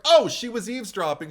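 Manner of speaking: high, loud "oh" of discovery; sudden realisation. Retold or imitated. Viewer acting out working out who the voice was, loudly enlightened. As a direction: burst out as the penny drops.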